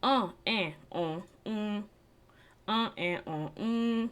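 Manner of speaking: uh, eh, uh, mm sung like warm-ups; vocal drill. Shown as a demonstration. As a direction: practice nasal vowels like voice lessons. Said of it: "you can go".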